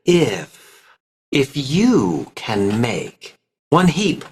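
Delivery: slow, stretched vowels, glued words; drawn out vowels. Shown as a demonstration. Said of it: "stretch out the vowels a little bit".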